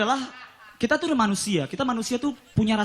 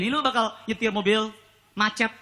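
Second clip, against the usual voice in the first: ordinary delivery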